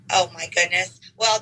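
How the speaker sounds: normal talking voice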